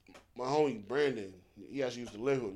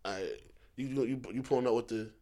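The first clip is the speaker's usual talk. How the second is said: mumbled slang; 7 semitones lower, 7 dB quieter than usual. Retold or imitated. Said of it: "I'll be like".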